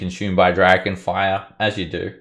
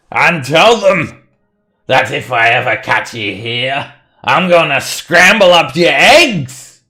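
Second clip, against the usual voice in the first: loud, raised, commanding shout; threatening dwarf; 7 semitones higher, 10 dB louder than usual. Acted. Dwarf warning the kobold off the mine, threatening shout. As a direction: lay down the law at full volume.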